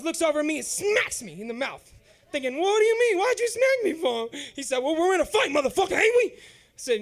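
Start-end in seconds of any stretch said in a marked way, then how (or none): 2.6-4.3 loud, high indignant yelp; outraged kid complaining; indignant protest. Retold or imitated. Smacked teen protesting to his uncle, loud and indignant. 4.8-6.3 louder, gruff mocking uncle; taunting uncle. Jailbird uncle taunting his nephew mid-fight, mocking.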